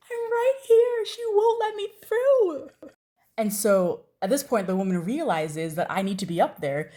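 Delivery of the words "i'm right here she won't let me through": high, louder, drawn-out plea; desperate call back. Retold or imitated. Teen blocked backstage calls to Wendy, high and pleading. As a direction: shout back that you're stuck.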